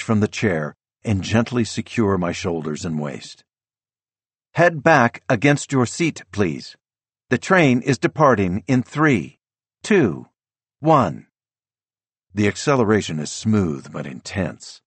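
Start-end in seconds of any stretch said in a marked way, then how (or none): 4.5-11.3 high, measured departure countdown; automated attendant voice. A narrator's reading. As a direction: count down like a train's recorded voice. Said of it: "the automated attendant says".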